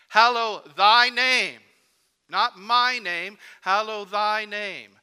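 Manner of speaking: "thy" hit loud, high and slow, "not my" set against it; slow, loud, lean on every "thy"; full-voiced emphasis on "thy" in the prayer; stress on thy. Shown as a demonstration. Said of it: "to emphasize the words, thy".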